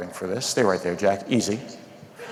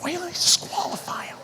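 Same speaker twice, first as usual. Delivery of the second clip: breathy whisper, high and soft; whispered aside; 4 dB quieter than usual. Acted. Comic whispering an aside to the audience. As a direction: let the crowd in on a secret grumble.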